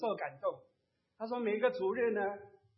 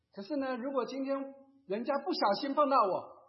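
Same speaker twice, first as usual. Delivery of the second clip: ordinary talk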